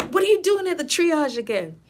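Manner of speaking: high-pitched, incredulous challenge; mock exasperated surprise. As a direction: imitate a nurse confronting a returning patient. Nurse confronts returning frequent flyer.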